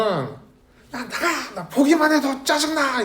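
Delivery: high-pitched mock-angry parent; scolding parent. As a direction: act out a parent telling off a child. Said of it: "막 애도 혼내고 나서는". (아이를 혼내는 부모 흉내)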